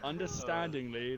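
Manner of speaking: normal talking voice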